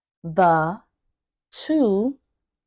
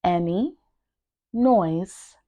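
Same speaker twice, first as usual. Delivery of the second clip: word by word; slower than usual. Shown as a demonstration. Teacher starting to break down a tongue twister, word by word. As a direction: separate words with a long pause. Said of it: "make sure that you are pronouncing each word".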